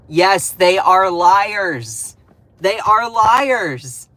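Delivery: each word hammered out slowly and loud; emphatic slow. Real, not acted. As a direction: spell it out word by word.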